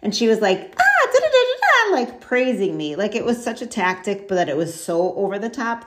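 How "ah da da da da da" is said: mocking gushing praise. Mocking her over-the-top praise.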